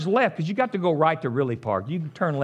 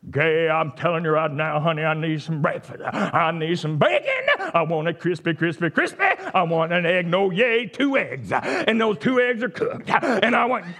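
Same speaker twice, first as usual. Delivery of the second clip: hammed-up preachy breakfast order; mock church-prayer demanding. Husband demanding breakfast from his wife in church-prayer style, hammed-up. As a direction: bellow the order like an overwrought prayer. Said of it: "we talk like this".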